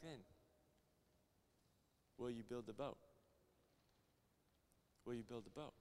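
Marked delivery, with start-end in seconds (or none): 2.2-5.7 hushed divine question. Retold or imitated. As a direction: ask it gently, very quietly. God's quiet question, retold.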